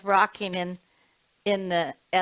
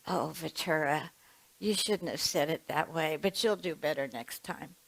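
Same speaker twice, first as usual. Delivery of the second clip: softer, kind scolding from a teacher; lightly voiced loving mentor, softer; gentle teacher; 3 semitones lower, 6 dB quieter than usual. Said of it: "She used to say".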